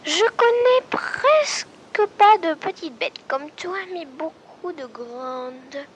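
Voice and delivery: high, slow doe voice; a proud doe, drawn out and lifted; doe's voice. Acted. The doe answers the squirrel, high and slow. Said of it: "La biche répondit".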